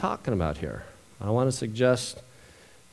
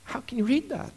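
emotional; 11 semitones higher, 4 dB quieter than usual. Real, not acted. Pastor moved after reading the verses, voice catching. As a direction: voice breaking higher with emotion. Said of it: "I get a little emotional".

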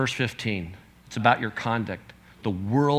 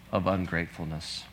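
ordinary delivery

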